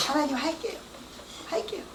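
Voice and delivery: soft, choked-up promise to God; a hint of weeping surrender; tearful prayer. Praying to God through tears, softly. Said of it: "하겠다고 하면서 막 울고 있는데".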